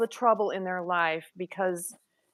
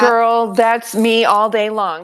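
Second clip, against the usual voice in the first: loud emphatic agreement; 7 dB louder, slower than usual. Real, not acted.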